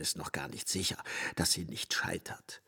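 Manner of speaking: soft, voiceless afterthought; whispered aside. Acted. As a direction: drop it into a whisper. Brichot's doubtful aside. (leise geflüsterter Nachsatz)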